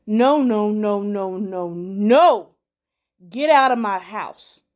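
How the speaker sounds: loud furious refusal; angry shout. Acted. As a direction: bark them out of the house. Derek throws them out.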